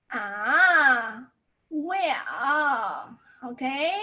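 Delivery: "Ah" and "well" drawn out with bending pitch; third tone. Shown as a demonstration. Teacher uses body language, bending tone on "Ah" and "well". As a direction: bend "Ah", "well" and "okay" reluctantly. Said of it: "the third tone".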